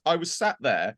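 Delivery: normal talking voice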